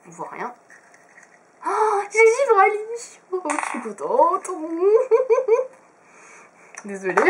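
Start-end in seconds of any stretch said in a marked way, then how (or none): 1.7-4.5 high, slow squeal of pure joy; excited cry. Real, not acted. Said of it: "ce cri qui vient du cœur". 4.5-5.7 high, loud burst of laughter; happy laugh. Real, not acted. Joy after the find.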